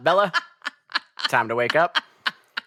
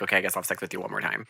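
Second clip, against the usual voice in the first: mock reluctant mumble; 7 semitones lower, 6 dB quieter, much faster than usual. Edward reluctantly agreeing.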